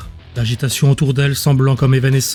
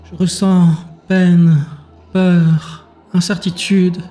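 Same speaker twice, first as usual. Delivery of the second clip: slow, higher telepathic voice, word by word; gentle creature voice; 4 semitones higher, much slower than usual. Acted. Creature speaks into Azur's mind, gentle. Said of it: "La voix avait été douce".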